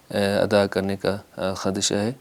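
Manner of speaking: ordinary talk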